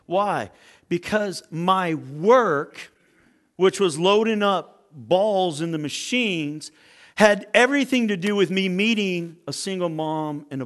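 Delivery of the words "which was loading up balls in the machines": usual speaking voice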